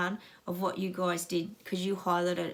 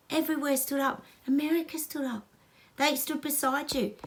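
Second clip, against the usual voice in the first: ordinary talk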